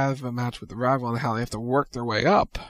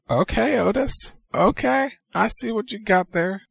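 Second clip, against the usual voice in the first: starstruck woman; 9 semitones higher than usual. Retold or imitated. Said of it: "and she's like".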